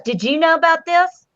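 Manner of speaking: high mock interrogation; imitated accusation. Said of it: "she goes".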